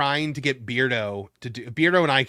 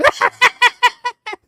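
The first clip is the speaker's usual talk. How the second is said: high, loud villain cackle; Joker cackle; 9 dB louder than usual. Acted. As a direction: burst into a manic villain laugh. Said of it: "I don't think my Joker was".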